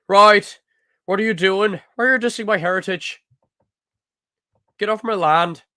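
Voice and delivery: loud, high Irish accent. Shown as a demonstration. Irishman telling someone to get off his land, shouted Irish accent. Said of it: "Also do a bit of Irish".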